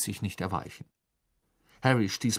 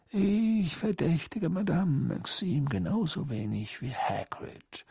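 slow, airy, composed reply; calm, drawn-out old wizard; unruffled; 7 semitones higher, slower than usual. A narrator's reading. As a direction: stay serene and take your time. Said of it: "sagte Dumbledore weiterhin gelassen".

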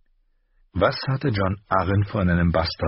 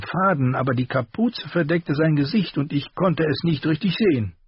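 higher, sharper armourer voice; the master's voice; 10 semitones higher than usual. A narrator's reading. Armourer rebuking his apprentice, higher voice. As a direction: scold the boy in a raised voice.

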